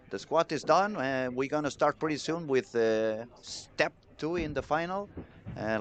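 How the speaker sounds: normal talking voice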